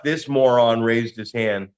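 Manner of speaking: ordinary delivery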